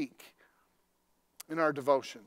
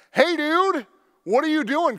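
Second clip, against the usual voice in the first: much higher and louder challenge; confronting shout; 11 semitones higher, 8 dB louder than usual. Retold or imitated. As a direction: scare off the stranger at the car. Yelling at stranger by car.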